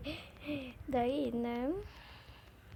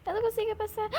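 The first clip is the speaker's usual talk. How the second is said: frustrated cry; 9 semitones higher, 5 dB louder, faster than usual. Real, not acted. Frustrated at failing the level.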